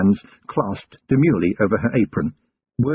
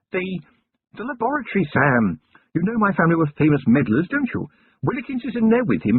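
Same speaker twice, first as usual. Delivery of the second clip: higher, refined lady's voice, curious; Lady Sybil voice; 9 semitones higher than usual. A narrator's reading. Wife questioning her husband about the goblin girl, higher refined lady voice. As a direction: play the poised lady of the house asking.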